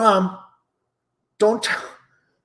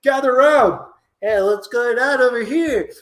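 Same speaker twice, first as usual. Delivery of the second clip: Bernard; 10 semitones higher than usual. Acted. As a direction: jeer like a schoolyard bully. Plays schoolyard bully Bernard.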